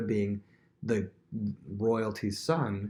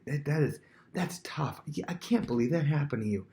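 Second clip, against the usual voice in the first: higher-pitched commiseration; feigned sympathy; 4 semitones higher than usual. Acted. Prince siding with a complainer, feigned sympathy. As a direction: commiserate as if stunned for them.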